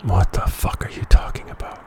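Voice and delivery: incredulous whisper; whispered aside. Real, not acted. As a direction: mutter it under your breath in disbelief. Aside reaction.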